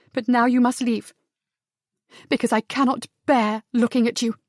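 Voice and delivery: anguished refusal. Acted. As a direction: push him away, voice up and strained. Heroine dismisses him in anguish.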